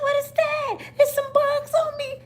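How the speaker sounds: very high, squeaky wail; mock panicked child. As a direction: squeal as if bugs are on you. Child panics about bugs.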